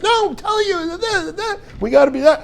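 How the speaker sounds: very high, mock-whiny fretting; nervous wife. Wife insisting to sleepy husband, mock-frantic whine. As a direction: put on a squealing, blathering worried voice. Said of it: "all nervous".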